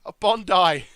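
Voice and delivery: loud, exaggerated Australian shout; terrible Australian accent. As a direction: belt it out in bad Aussie. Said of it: "my terrible Australian accent".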